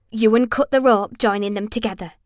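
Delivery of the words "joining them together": usual speaking voice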